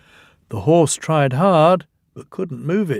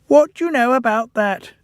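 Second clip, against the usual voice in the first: gloating Percy; 11 semitones higher than usual. A narrator's reading.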